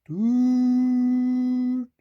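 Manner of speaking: long high train whistle; drawn-out whistle on one high note; long, sad toot. A narrator's reading. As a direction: hold the toot like a mournful locomotive. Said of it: "trying to call out the long, sad".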